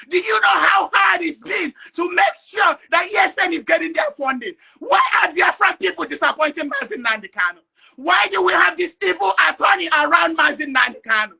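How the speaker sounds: loud angry rant, voice cracking near tears; real outrage, shouting down the line; furious shouting. Real, not acted. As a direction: hammer each question at the listeners. Impassioned protest rant.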